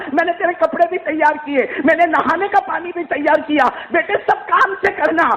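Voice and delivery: father weeping as he leaves his son; tearful farewell. Acted. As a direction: choke through the goodbye.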